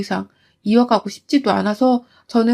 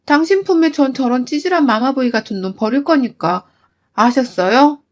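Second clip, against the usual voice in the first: normal talking voice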